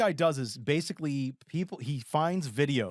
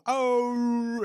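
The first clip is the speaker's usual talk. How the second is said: long, high, loud howl; drawn-out mock canine wail; imitated dog wail; 11 semitones higher, 5 dB louder than usual. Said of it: "like, wailing".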